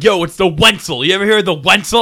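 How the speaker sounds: put-on loud drunken boast; drunk bragging. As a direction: brag it loudly, half-drunk.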